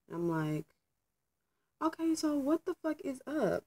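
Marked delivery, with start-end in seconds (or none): 1.8-3.6 retold exasperated question, pitched high; sharp, fed-up demand, voice jumping up; irritated retort. Facing the investigator at work, an irritated high-pitched demand. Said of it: "At that point, I'm already angry".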